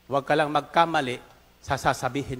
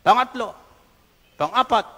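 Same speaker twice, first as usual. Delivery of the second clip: ordinary talk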